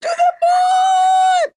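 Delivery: very high hype shout. Pretend. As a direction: scream it like a crypto bro. Crypto hype chant.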